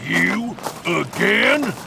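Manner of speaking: You? Again? flung up high in disbelief; exasperated surprise. Acted. Thug spotting his old rival, indignant surprise. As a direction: jump up in pitch, fed up at seeing him.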